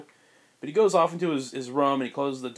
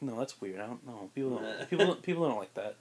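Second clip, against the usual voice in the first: muttered doubt; 7 dB quieter than usual. Retold or imitated. Carol dismissing her robot idea.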